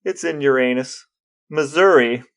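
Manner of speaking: ordinary delivery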